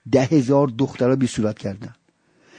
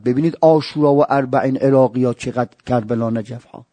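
normal talking voice

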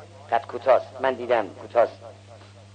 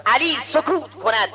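high, raised-voice announcement; challenging proclamation; 7 semitones higher, 3 dB louder than usual. Acted. Scholar telling all but Ali to answer, challenging proclamation. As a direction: throw the challenge out to the whole room. Scholar throws question to everyone.

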